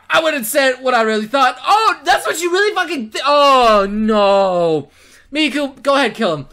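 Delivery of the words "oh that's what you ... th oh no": loud disbelief sinking into a groan; appalled outburst. Real, not acted. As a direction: blurt it out, then wail in dismay. Appalled at character's blunder.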